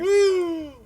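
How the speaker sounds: high-pitched "woo" held out; high whoop. Real, not acted. Game master reacting to a room mix-up, high whoop.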